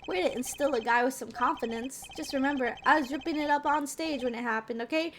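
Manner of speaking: ordinary delivery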